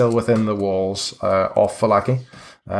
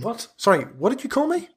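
quick, high-pitched challenge; taken aback; 12 semitones higher, 4 dB quieter than usual. Acted. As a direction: demand a repeat, voice shooting up. Gravedigger reacts to being called suspicious.

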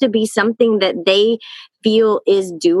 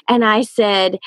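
ordinary talk

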